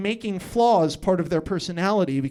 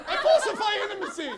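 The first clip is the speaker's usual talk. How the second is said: high line dissolving into laughter; can't get through it without cracking up; giggling confession; laughing; 13 semitones higher than usual. Real, not acted.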